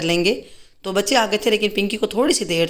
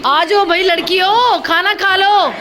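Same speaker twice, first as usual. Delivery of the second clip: calling out; 8 semitones higher, slower than usual. Real, not acted. Calling the girls to dinner.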